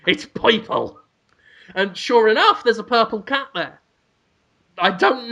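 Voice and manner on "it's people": rough mock pet-store seller; put-on New York-style character voice; Danny DeVito. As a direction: pitch the cat in caricature. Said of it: "Danny DeVito says".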